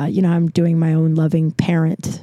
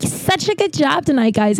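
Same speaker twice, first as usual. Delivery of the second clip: high-pitched imitation of a gushing castmate; mock gushing praise; 8 semitones higher than usual. Castmate praising everyone else after the show, mock gushing. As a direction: imitate her sugary congratulations, pitched well up.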